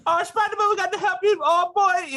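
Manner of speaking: very high-pitched fake Jamaican accent; badly faked Jamaican squawk, way up high. Mocking the cartoon taxi driver, bad Jamaican accent. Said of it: "that's how bad the accent is".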